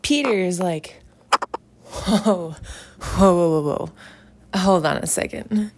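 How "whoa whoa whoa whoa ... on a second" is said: loud, mock-alarmed "whoa"; startled objection. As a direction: cut in to halt someone, loud and taken aback. Peter objecting in acted retelling.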